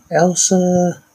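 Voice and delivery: name sung out high and bright; excited shout-out. Acted. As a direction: call the name like a cheer. Shouting out friends' names.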